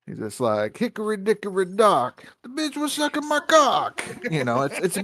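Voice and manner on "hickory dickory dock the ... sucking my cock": Andrew Dice Clay. Acted. Friend imitating a shock comic's dirty nursery rhyme, loud Brooklyn impression. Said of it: "listen to Andrew Dice Clay".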